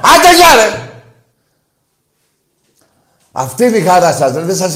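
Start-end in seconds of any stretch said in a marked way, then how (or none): 0.0-0.9 shouts it much higher and louder; angry brush-off shout. Real, not acted. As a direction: slam the door on the caller with your voice. Host hangs up on caller. (φωνάζει θυμωμένα)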